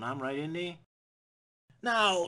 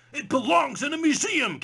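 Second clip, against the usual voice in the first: much higher, louder proclamation; mock heroic catchphrase; 11 semitones higher, 6 dB louder than usual. Quoting Indy's catchphrase, hammed-up. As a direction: announce it grandly.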